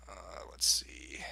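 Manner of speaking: slow whispered aside; whispering. Real, not acted. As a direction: whisper it slowly to yourself. Muttered aside while checking data.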